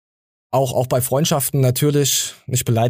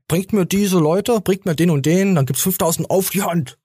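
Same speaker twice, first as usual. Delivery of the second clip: quoting Matthias; 6 semitones higher than usual. Retold or imitated. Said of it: "Matthias hatte quasi auch immer gesagt".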